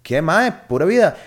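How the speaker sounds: upbeat retold greeting; cheery greeting. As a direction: play along with a friendly hi. Greeting stranger who knows him.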